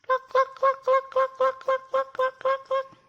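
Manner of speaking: very high, loud clucking; hen clucks. Pretend. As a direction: cluck away like a busy hen. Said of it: "the sound is like this".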